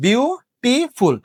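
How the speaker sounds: Beautiful slowly broken into বিউ, টি, ফুল; বিউ... টি... ফুল, slow and separated; syllable by syllable. Shown as a demonstration. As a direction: count out beautiful with pauses between syllables. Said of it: "তার syllable কয়টা".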